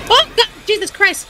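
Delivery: jolted, pitch leaping up on the first words; startled outburst. Real, not acted. Player jumped by a robot in the game, startled.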